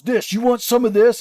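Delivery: high-pitched, agitated mock threat; imitated aggressive challenge. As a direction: imitate a worked-up guy picking a fight. Attacker repeats his challenge to instructor.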